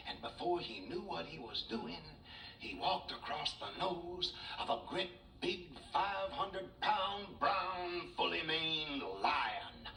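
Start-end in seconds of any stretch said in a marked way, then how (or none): 2.6-9.9 slow, drawn-out, higher narration; suspenseful storytelling. A narrator's reading. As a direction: stretch out each word for a big reveal.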